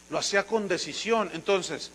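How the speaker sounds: ordinary talk, nothing marked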